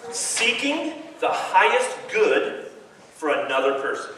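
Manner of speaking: slow, weighty words for them to remember; slow emphatic definition. Shown as a demonstration. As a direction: lay it down slowly, word by word. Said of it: "I wanna give you a definition".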